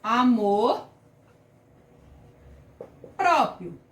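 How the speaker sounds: "Amor" then a long pause before "próprio", both said slowly and clearly; spaced-out example word. Shown as a demonstration. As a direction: present "Amor" and "próprio" word by word for the class to write. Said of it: "O exemplo é a palavra amor próprio". (palavra por palavra, "Amor" e "próprio" bem pausados)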